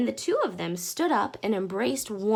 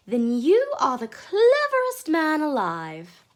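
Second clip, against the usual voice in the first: admiring lady's voice; 7 semitones higher, 7 dB louder than usual. Acted. Mrs Silver admiring, slow.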